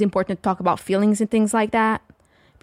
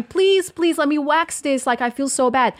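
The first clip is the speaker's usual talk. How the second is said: mock crying plea; 6 semitones higher than usual. Daughter begging her mom to let her wax, mock crying plea.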